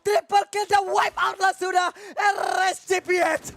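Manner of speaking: hyped-up shouting play-by-play; fighting game announcer. Real, not acted. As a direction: yell the triple kill call. Caster calls a wipe out.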